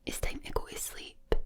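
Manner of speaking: whispered Glaswegian bedtime line, sounds dropped; proper Glaswegian. Shown as a demonstration. Glaswegian sending the listener to sleep, whispered Glaswegian accent. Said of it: "proper Glaswegian".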